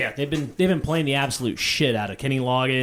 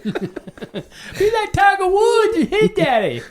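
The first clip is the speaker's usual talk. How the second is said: very high, drawn-out comic yell; Waterboy dad impression; 4 dB louder, slower than usual. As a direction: squeal it slowly as a movie dad. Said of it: "his impression of, uh, the dad from, uh, Waterboy".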